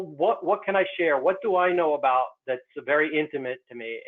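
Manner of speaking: ordinary talk, nothing marked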